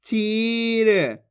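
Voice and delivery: चीर very slow with a long ee; drawn-out vowel. Shown as a demonstration. Teacher joins the sounds, चीर drawn out. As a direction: stretch the ई in चीर. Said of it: "जिस तरीके से मैं खींच खींच के पढ़ रहा हूँ".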